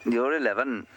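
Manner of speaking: high, lilting go at a Welsh accent; badly done Welsh accent with swooping pitch; attempted Welsh accent. Shown as a demonstration. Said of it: "Welsh accent that I can muster".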